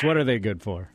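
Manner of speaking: ordinary talk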